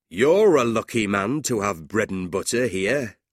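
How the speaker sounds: a hint of a teasing, hearty man; lightly voiced blunt man. A narrator's reading. John teasing the new teacher over bread and butter, blunt.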